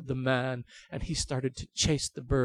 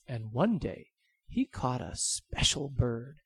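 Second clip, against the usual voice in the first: plain reading voice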